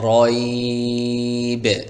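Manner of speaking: long sung ending on রয়বে; drawn-out recitation. Shown as a demonstration. Teacher chants রয়বে for students. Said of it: "তাহলে এভাবে পড়তে হবে".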